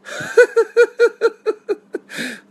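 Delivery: high, loud laugh; burst of laughter. Real, not acted. Host laughing at a viewer's comment, high and loud.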